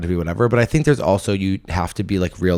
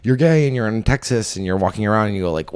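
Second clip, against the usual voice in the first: ordinary talk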